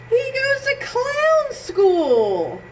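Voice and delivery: high slow amazed reveal; delighted surprise. Acted. Reading the twist ending to kids, high slow amazement.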